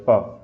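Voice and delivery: "प" released from fully closed lips; plosive demo. Shown as a demonstration. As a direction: close the mouth fully, then pop out "प". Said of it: "मैंने mouth पूरा बंद कर लिया".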